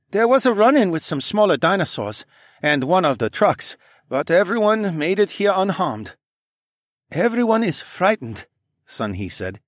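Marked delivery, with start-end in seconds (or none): 0.1-6.2 lightly voiced young man; tired but steady; higher male character. A narrator's reading. Tae character voice. 7.1-8.5 put-on higher, lightly accented man; Sun He voice. A narrator's reading. Sun He character voice.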